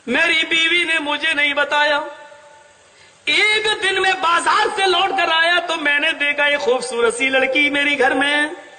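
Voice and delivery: ordinary talk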